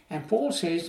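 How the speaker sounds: ordinary talk, nothing marked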